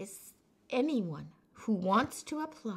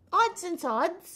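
announcing title; 9 semitones higher, 7 dB louder, slower than usual. Pretend.

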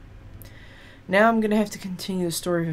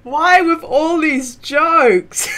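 high, loud words through laughter; laughing; 9 semitones higher, 12 dB louder, much slower than usual. Real, not acted. Gamer laughing at the game's jokes, loud laughing question. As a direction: ask it while still laughing hard.

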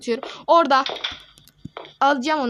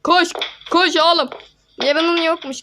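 urgent shout; 6 semitones higher, 8 dB louder than usual. Real, not acted. Urging himself to run.

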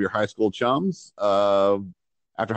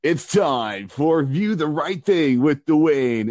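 slow, booming radio host intro; radio announcer voice; 6 semitones higher, 3 dB louder, much slower than usual. Shown as a demonstration. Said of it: "I'm gonna turn my radio voice off".

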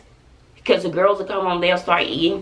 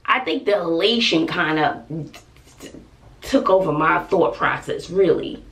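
ordinary talk, nothing marked

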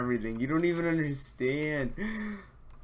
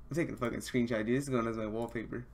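ordinary talk, nothing marked

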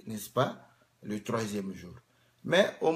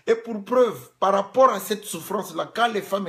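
higher, louder preaching; fiery preaching; 7 semitones higher, 7 dB louder than usual. Acted. Preacher proving his point to the congregation, raised and forceful. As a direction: proclaim the proof.

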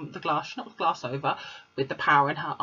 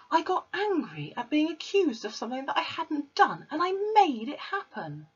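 put-on higher little girl voice, pleading; Matilda; 10 semitones higher than usual. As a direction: plead your innocence as a child. Matilda confessing.

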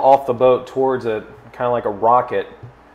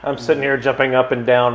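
ordinary talk, nothing marked

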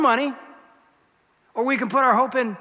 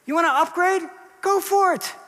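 higher, breezy go-ahead; higher, lightly mocking permission; permissive voice; 8 semitones higher than usual. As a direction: toss it off like an easy yes. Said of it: "He's saying".

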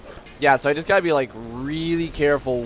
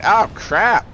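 sudden loud, higher yelp; startled outburst; 8 semitones higher, 7 dB louder than usual. Real, not acted. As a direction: blurt it out, breaking off mid-thought. Lava hits player mid-sentence.

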